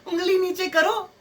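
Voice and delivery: high, slow, put-on command; mock scolding woman. Girlfriend interrupting an argument over pointing, mock scolding. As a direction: imitate her sudden reprimand, drawn out and high. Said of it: "अचानक से एक आवाज आएगी".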